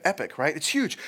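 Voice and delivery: ordinary talk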